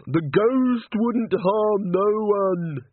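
very high, very slow pleading; insistent simple young man. Acted. Walter insisting to Nanny, very high and slow. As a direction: Walter defending the ghost, high and drawn out.

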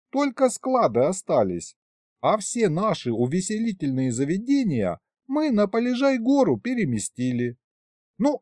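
lightly voiced grandpa. A narrator's reading. Grandpa setting his grandson straight, lightly voiced. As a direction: explain it as a fussy grandpa would.

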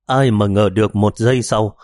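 usual speaking voice

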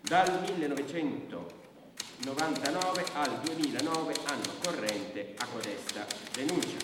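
slow official dictation. Acted. Brigadier dictating dates to the record, slow and loud. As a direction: dictate each word for the typist.

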